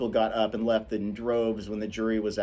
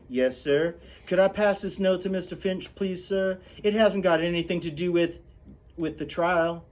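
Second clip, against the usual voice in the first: higher, deferential servant's voice; polite Calpurnia; 7 semitones higher than usual. Acted. Calpurnia politely asking the judge to pass a note. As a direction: ask the judge's leave humbly.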